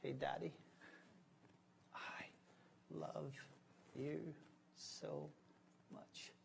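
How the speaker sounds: hushed, word-by-word retold whisper; imitated whisper. Father retelling his daughter's words in his ear, hushed whisper. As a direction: murmur it softly, a pause between each word. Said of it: "she whispered in my ear".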